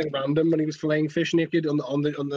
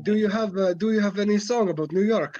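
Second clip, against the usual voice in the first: higher-pitched bad Israeli accent, retold; terrible Israeli accent; 5 semitones higher than usual. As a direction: imitate a badly done Israeli accent. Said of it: "doing a really terrible Israeli accent".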